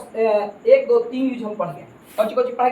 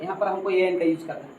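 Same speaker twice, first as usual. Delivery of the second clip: ordinary talk, nothing marked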